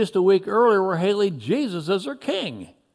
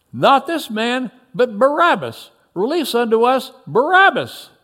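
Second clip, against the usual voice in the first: raised, high crowd demand; shout; 4 semitones higher, 4 dB louder than usual. A narrator's reading. The crowd demands Barabbas from Pilate, shouting. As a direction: call it out insistently. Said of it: "And then they shouted".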